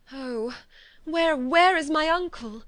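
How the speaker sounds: urgent, high, loud cry; cried out. A narrator's reading. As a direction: call out desperately for her uncle. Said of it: "cried Elizabeth".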